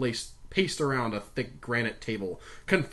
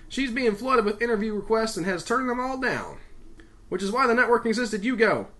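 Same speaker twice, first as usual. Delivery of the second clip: higher, forceful briefing voice; insistent boss giving orders; commanding boss; 9 semitones higher, 4 dB louder than usual. A narrator's reading. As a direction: take command of the room. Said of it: "taking command like an old sea captain".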